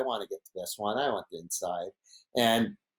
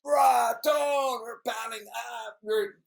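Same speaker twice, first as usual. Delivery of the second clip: much higher, yelling, imitating a frantic friend; panicky Brock; 11 semitones higher, slower than usual. Imitating Brock pleading with his friend, high frantic yell. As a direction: squeal it like he's sliding off the wave. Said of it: "He was like".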